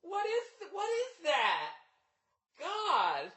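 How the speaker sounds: put-on very high, soft gossipy girl voice; mock gossiping girlfriend. Mocking women venting about men to friends, in a high soft gossipy voice. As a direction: squeak it like girlfriends gossiping at a wine bar. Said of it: "with your female friends just going".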